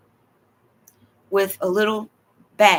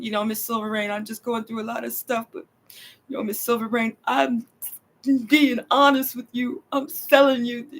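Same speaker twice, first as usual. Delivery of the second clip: imitating a crying man; 2 semitones higher than usual. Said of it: "he's crying".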